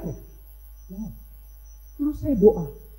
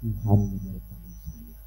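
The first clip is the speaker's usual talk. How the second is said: calm reassurance; 5 semitones lower, 9 dB quieter than usual. Retold or imitated. Grandma tells priest she is no longer afraid, calm and soft. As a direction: let it settle quietly, trailing lower. Woman's peaceful answer, retold softly.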